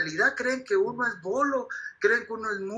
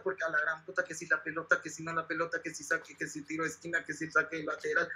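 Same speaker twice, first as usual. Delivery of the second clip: mocking drone; 7 semitones lower, 8 dB quieter than usual. Mocking boring football talk.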